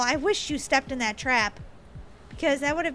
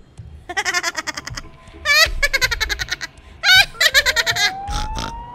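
long high cackle ending in snorts; laugh with snort; 10 semitones higher, 3 dB louder than usual. Real, not acted. [name] gloats over killing Daisy, snorting cackle. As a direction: cackle helplessly until it turns into a snort. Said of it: "I snorted".

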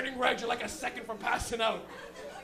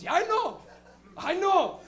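higher, louder retort as dad; mock exasperated father; 6 semitones higher, 7 dB louder, slower than usual. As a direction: imitate a fed-up dad snapping back.

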